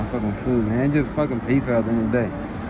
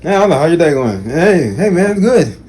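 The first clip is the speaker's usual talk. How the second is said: mock friendly greeting; 6 semitones higher, 6 dB louder than usual. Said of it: "he like".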